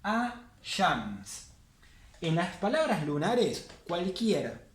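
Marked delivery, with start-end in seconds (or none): 0.0-1.5 'A-shams' said with no L at all; solar article, silent L. Shown as a demonstration. Teacher repeats the solar example, L-less 'A-shams'. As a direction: leave the L out of 'A-shams', going straight to sh. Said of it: "la L no la vamos a leer".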